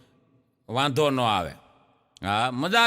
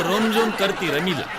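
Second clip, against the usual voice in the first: laughing; 2 semitones lower than usual. Retold or imitated. Instagram ID read out, laughing.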